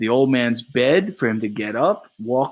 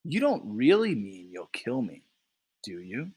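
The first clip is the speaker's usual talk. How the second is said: softer, calm quiet question; softly; 6 dB quieter than usual. Acted. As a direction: ask it gently to calm him.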